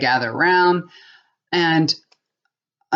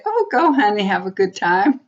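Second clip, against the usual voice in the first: "Oh, go, honey" sung out much higher, sugary; mock sweet wife; 4 semitones higher than usual. Wife mocking the sweet answer she won't give, hammed-up sweetness. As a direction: play the sweet, permissive wife, sarcastically. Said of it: "be all nice and sweet and say".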